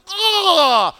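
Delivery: loud, high imitated gasp of "oh"; acted shout of amazement; awestruck cry. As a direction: cry out as if overwhelmed. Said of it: "Can you imagine that? ... You're like".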